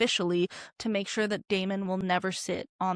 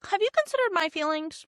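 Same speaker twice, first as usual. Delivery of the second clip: whiny Daemon; 7 semitones higher, 3 dB louder than usual. Retold or imitated. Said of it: "Daemon is like".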